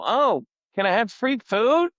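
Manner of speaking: mock plea. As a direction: turn their request back on them with a mocking plea. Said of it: "I just wanted to go".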